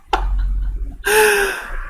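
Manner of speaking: high, quiet helpless giggle; real laughter. Real, not acted. Player cracking up at a lucky draw, laughing. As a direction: laugh softly and high, unable to stop. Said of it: "I'm laughing".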